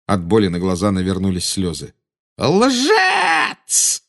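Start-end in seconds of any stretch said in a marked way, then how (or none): 2.4-4.0 very high, furious shriek; screaming. A narrator's reading. Witch refuses to believe the boy, screaming. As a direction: scream the accusation, fear underneath. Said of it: "завопила она".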